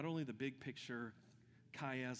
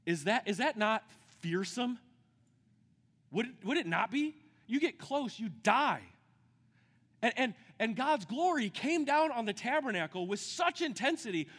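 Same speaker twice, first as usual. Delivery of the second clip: loud, high, slowed-down preaching shout; preaching at full force; 10 semitones higher, 9 dB louder than usual. Acted. Pastor to the congregation on the Day of Atonement, preaching at full force. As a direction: build the question up and cry it out to the room. Preaching on God's fearsome glory.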